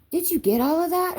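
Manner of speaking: high, keen storybook question; high-pitched question. A narrator's reading. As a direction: voice lifted high in an eager question. Excited question from John in narrated story.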